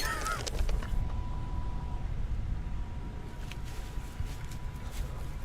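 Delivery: long, quiet, breathless laugh; soft voiceless giggling fit. Real, not acted. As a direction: wheeze with laughter at yourself. Laughs at own whiny yell.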